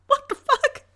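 can't believe he said that; incredulous laugh. Real, not acted. Reacting to rude date's comment.

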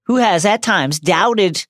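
lofty proclamation, slowed; grand announcer. Pretend. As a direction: proclaim it like a tribute. Mock tribute speech.

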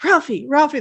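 calling out. Retold or imitated. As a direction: call out to a friend mid-fight. Kids calling out to Ralphie.

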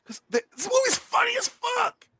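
comically high, strained squeal; squealed comic exclamation; high-pitched outburst. Acted. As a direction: shoot way up into a mock yell.

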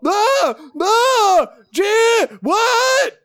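short high loud yelps; hammed-up cries of a man being mauled; yelps of panic. Comedian character mid-maul as someone walks in, panicked yelping.

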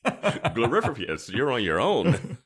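loud, slow, laughing farewell; mock calling out. Minions abandoning their mistress, loud mock send-off.

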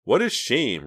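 mock sympathetic sigh. A narrator's reading. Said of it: "sighing sympathetically".

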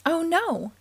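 plain reading voice

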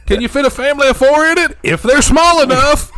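high, loud brash pitch; hammed-up pitchman. Parodying a dealer's ad, loud brash pitch. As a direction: bark it like a no-limits dealer.

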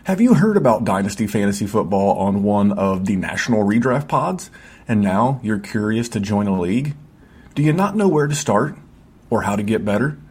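upbeat promo voice-over read. Acted. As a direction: sell the Patreon like a radio spot. Podcast ad read over music.

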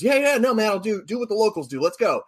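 high, quick, eager agreement; Jon Hamm. Retold or imitated. Star agrees to drink what the locals drink, eager. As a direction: imitate an easygoing guy who is all in.

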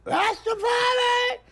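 very high, drawn-out falsetto crow; slow piercing cock-a-doodle delivery; rooster crow. Retold or imitated. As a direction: crow the word like a cockerel. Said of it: "says the cock".

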